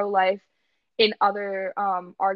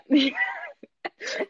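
high-pitched laugh. Real, not acted. Giggling over each other.

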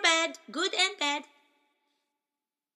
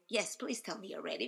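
normal talking voice

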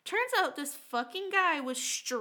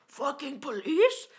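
slow, loud imitation of a ranter; crazy aggressive man; 5 dB louder, slower than usual. Crazy guy spots the cops, mock aggressive outburst. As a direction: drag it out loudly in mock outrage. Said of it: "the crazy guy".

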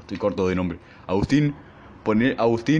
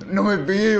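1 mock scared plea; 11 semitones higher, 3 dB louder than usual. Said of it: "estaba cagado de miedo".

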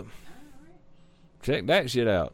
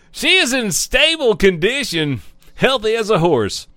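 news read; 5 semitones higher, 4 dB louder than usual. Acted. Reading news article aloud.

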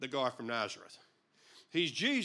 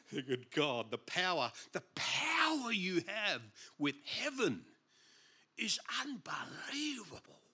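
awestruck; 4 dB quieter than usual. Real, not acted. Preacher marvelling at God's power, awestruck. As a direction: draw it out in wonder, softer to the end.

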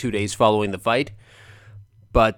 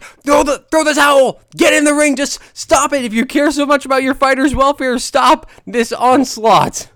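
high, loud exasperated shouting; frantic yelling; 12 semitones higher, 7 dB louder than usual. Retold or imitated. Fan screaming at trainer to stop the fight, loud. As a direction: yell at the trainer to get in the ring. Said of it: "I'm just like".

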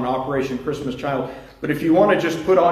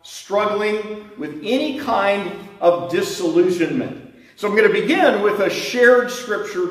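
preaching; 4 semitones higher than usual. Acted. Preaching, slow and lifted.

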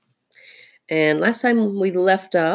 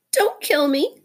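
high, pleading little muskrat voice; almost weeping; 12 semitones higher than usual. A narrator's reading. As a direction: beg for mercy, high and tearful. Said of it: "said Chakundra, almost weeping".

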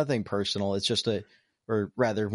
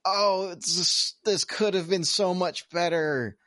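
disappointed; 8 semitones higher, slower than usual. Retold or imitated. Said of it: "I was like".

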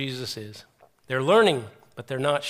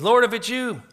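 high, loud call across water; raised-voice imitation of Peter calling; 7 semitones higher, 6 dB louder than usual. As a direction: call out urgently to someone far off. Peter calls to Jesus across water.

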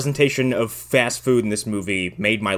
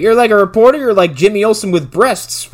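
goofy hero; 10 semitones higher, 7 dB louder than usual. Retold or imitated. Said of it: "He's like".

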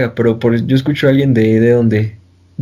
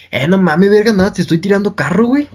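mock Monterrey accent, higher at the start; regio accent; 5 semitones higher than usual. Quoting how regios tease a friend, in mock regio accent. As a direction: imitate regio teasing talk. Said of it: "Pero esos güeyes te dicen".

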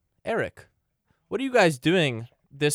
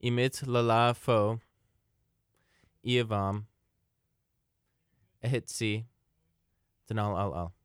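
backwards speech; 4 semitones lower, 5 dB quieter than usual. Shown as a demonstration. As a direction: say the message in reverse. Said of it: "I'm just gonna say something super important right now, uh, backwards".

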